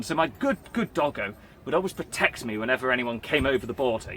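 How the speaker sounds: ordinary delivery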